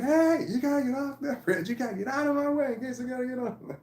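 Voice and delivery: high mock yell from a hill; retold yell. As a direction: yell it up high at the officers, half laughing. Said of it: "And he was yelling".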